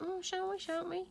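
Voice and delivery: high mock musing; playful high-pitched dithering; musing aloud. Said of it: "you're like".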